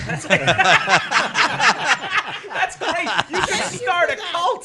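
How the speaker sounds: high, laughing through the words. Real, not acted. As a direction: giggle it out. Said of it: "laughing while talking".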